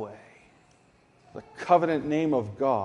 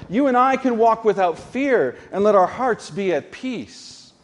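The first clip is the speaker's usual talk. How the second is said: higher, louder sermon climax; rousing the congregation with full conviction; fervent preaching; 5 semitones higher, 4 dB louder than usual. Acted.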